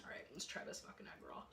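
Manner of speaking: fast, breathy whisper; hushed aside before a bite. Real, not acted.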